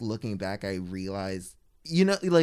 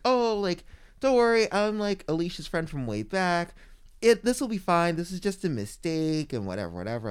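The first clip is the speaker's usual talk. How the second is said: mock reassurance; 7 semitones higher than usual. Friend voiced reassuring.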